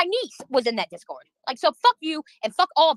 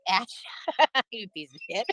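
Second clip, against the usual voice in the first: usual speaking voice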